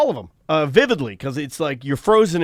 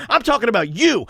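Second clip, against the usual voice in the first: high, insistent accusation; retold angry retort; 6 semitones higher than usual. Refusing the driver's excuse, retold angry insistence. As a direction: re-enact snapping back at the driver. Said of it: "And I'm yelling".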